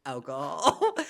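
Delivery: matter-of-fact with a dramatic pause; imitated bartender deadpan. Bartender repeating his job, paused deadpan. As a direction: pause before the last word, no excitement. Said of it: "Like, he just goes".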